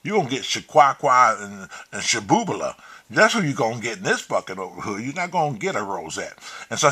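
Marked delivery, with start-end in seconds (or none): none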